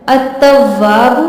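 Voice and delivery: अत्तव्वाबु recited slowly, लाम silent, doubled त; recitation voice. Shown as a demonstration. Teacher recites a divine name as an example, slow recitation tone. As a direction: intone अत्तव्वाबु like Quran reading. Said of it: "ता भी हरूफ शम्स है".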